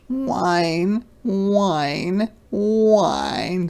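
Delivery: high, insistent puppy whine; long, high cub whimpers; upset whining cub. Acted. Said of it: "the cub is really upset".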